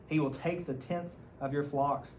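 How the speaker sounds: usual speaking voice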